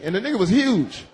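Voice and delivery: ordinary delivery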